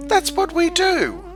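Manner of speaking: very high, loud and puzzled; high squeaky insect protest; surprised dragonflies. A narrator's reading. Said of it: "said the dragonflies in surprise".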